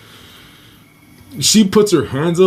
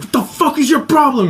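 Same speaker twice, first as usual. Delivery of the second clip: much higher, retold angry outburst; mom losing it; 12 semitones higher than usual. Mom shaking the man by his collar, losing it. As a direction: imitate a furious mother's challenge. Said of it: "My mom lost her shit".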